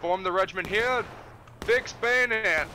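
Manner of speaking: high, loud, slow command; shouting orders over battle. Acted. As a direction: draw out the order across the field. Officer forming line in battle.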